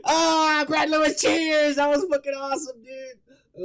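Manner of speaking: loud, high cheering toast; excited shout. Real, not acted. Toasting the commenter.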